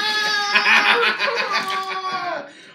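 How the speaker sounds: loud squealing laugh burst; high shrieking laugh. Real, not acted. Reacts to Justine's "No!.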